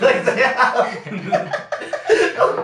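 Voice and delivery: slow and loud, the words stretched out by laughter; barely able to talk for laughing; laughing hard. Real, not acted. Mocking the cheap bubble effect to friends, laughing hard.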